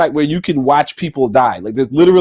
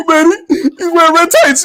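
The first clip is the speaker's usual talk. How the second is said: mock weepy fan; 11 semitones higher than usual.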